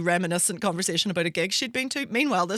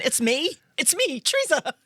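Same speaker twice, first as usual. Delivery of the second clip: high, louder mock reminder, spelling it out; over-polite reminder of who she is; 10 semitones higher, 4 dB louder than usual. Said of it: "I'll always start by saying".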